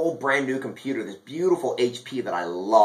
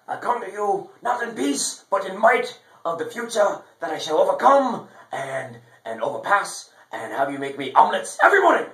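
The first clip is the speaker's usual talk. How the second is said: grandiose villain voice; 7 semitones higher than usual. Pretend. Grand villain monologue to the viewers.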